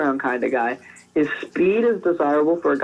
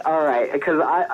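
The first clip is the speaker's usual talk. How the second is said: ordinary delivery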